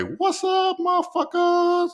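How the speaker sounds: high, drawn-out yelled greeting. Pretend. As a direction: burst through the door calling out to the whole room. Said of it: "bust up in that motherfucker and say".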